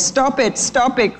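a mild, understated plea to stop; crying out. A narrator's reading. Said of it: "cried the little birds".

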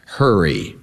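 usual speaking voice

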